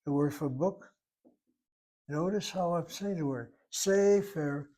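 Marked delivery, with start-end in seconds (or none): 3.7-4.7 sefer with SE stretched and hit hard; stress on first syllable. Shown as a demonstration. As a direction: hit the SE in sefer, leave fer light. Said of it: "I'm hitting the first syllable".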